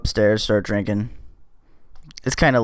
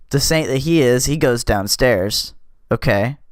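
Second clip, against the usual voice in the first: usual speaking voice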